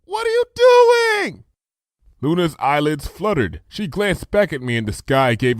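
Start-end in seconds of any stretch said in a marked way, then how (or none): 0.0-1.4 high, slow, loud call; high shouted question across a distance; alarmed shout. A narrator's reading. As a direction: yell down in worry. Shouting down from the tower.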